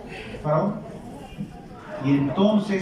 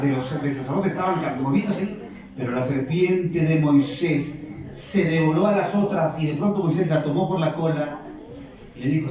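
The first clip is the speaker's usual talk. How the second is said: ordinary talk, nothing marked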